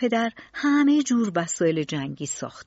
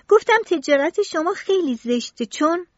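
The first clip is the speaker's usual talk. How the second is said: put-on higher child's voice, insistent; boy repeating; 8 semitones higher, 4 dB louder than usual. A narrator's reading. Tistou repeating his complaint to Tronadis, high and insistent. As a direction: say it again, plainly defiant.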